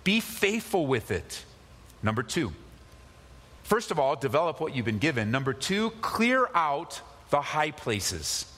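normal talking voice

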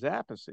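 Appice said "AP-pih-see"; three syllables in Appice, stress up front; father's pronunciation. Real, not acted. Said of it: "what my father said is Appice".

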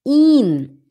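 "In" high and held, like "eeen"; long ee on "In", falling at the end. Shown as a demonstration. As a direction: draw the vowel of "In" out. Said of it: "Longo é como se fosse ele".

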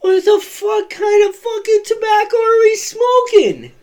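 put-on a teen stunned by a three-foot bong, yelling; shocked yell. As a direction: shriek your disbelief at the giant bong.

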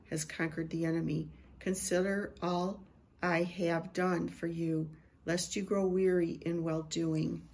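measured, solemn prophetic delivery; prophetic word. Acted. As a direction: speak as the voice of God to his people.

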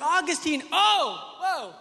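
high, loud recoil from a mess; acted-out startled protest; alarmed dad. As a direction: fend off a messy toddler in a panic. Dad recoils from messy son.

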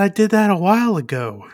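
mock teenage offhand confession; thirteen-year-old daughter. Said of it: "that typical thirteen-year-old response".

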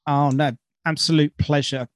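normal talking voice

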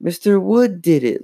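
high, insistent blame; accusing cry. A narrator's reading. Lindsay blames the dummy to Mom, accusing. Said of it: "Lindsay accused".

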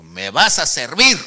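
loud, slow, commanding; booming voice of God. Retold or imitated. God calling the reluctant man a second time, booming voice of God. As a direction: thunder the command slowly, as God. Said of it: "Dos veces la voz potente".

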